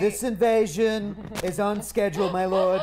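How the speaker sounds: Trump voice. Acted. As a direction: lightly do Trump. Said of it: "What was my Newt voice again? ... Trump".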